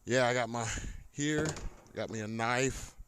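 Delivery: ordinary delivery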